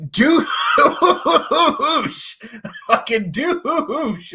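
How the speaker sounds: loud, high yell broken by a laugh; real laughing yell at a movie villain. Real, not acted. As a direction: yell at the screen through a laugh.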